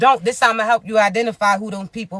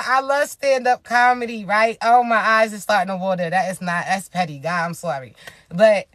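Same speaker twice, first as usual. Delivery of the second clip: normal talking voice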